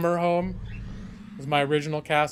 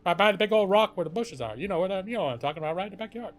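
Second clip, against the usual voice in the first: tall-tale storytelling; 3 semitones higher, 5 dB louder than usual. Acted. Streamer telling chat a hammed-up childhood yarn. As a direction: spin it like a grand adventure.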